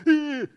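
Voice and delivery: loud, high mock exclamation of shock; startled grunt. Reader reacting to an all-caps message, loud mock grunt. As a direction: flinch at a shouty message. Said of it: "I'm like".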